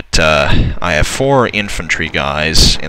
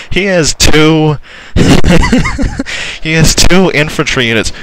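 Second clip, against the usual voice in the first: higher and louder, laughing through the words; laughing disbelief; 9 semitones higher, 4 dB louder than usual. Real, not acted. Gamer mocking the enemy's two units, laughing high. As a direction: giggle out the tiny number in disbelief.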